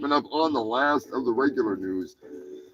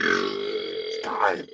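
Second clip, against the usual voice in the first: much lower, put-on deep voice; mock deep voice; 4 dB quieter than usual. Host echoing the jingle in a mock deep voice. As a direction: growl it like a movie trailer.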